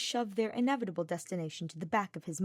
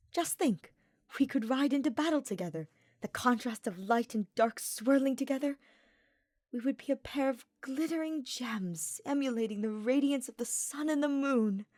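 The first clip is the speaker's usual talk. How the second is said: higher, dreamy romantic knight; wistful young nobleman; 6 semitones higher than usual. A narrator's reading. Ferdinand dreaming of riding with Hubert, higher wistful voice. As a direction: paint the picture, lifted and starry.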